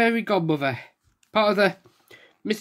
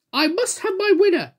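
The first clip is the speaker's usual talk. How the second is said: crying out; 12 semitones higher than usual. Acted. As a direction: demand the winner be found. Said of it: "cried Little Miss Splendid".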